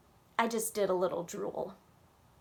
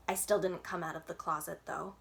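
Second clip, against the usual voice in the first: ordinary delivery, nothing marked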